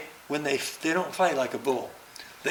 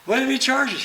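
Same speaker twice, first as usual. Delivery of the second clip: high, anxious protest from the cameraman; high, worried film crew voice; 12 semitones higher, 6 dB louder than usual. Retold or imitated. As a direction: voice the nervous camera guy, pitched up. Crew member voiced again.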